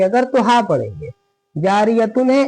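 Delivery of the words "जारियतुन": जारियतुन with the final -tun sounded; wrong stop with -tun. Shown as a demonstration. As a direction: end जारियतुन on t and n. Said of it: "इस तरह सांस टूटी तो नहीं". (जारियतुन, तुन पूरा पढ़ के)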